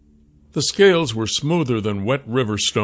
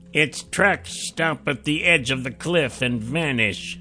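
higher, terse ranger's report; ranger Shum; 5 semitones higher, 3 dB quieter than usual. A narrator's reading. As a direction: report it plainly like a scout.

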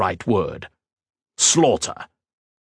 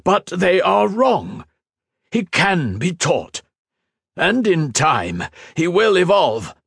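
much higher, louder, slow insistence; restrained anger; 11 semitones higher, 3 dB louder than usual. A narrator's reading. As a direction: fight not to shout. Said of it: "an enormous effort for him not to shout".

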